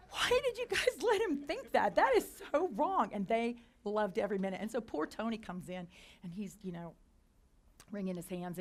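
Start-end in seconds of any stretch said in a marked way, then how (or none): 0.1-3.1 high, loud indignant outburst; outraged retort. Retold or imitated. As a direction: scold them, shocked and indignant. Her outraged retort to coworkers.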